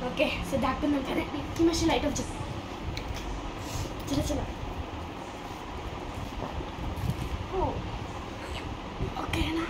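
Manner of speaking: hushed whisper under the bed; whispering while hiding. Real, not acted. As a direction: keep it whispered so nobody hears.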